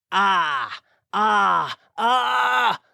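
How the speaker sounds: short rising cries of fright; frightened scream. A narrator's reading. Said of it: "he screamed".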